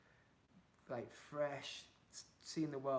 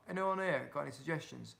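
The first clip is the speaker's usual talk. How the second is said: ordinary delivery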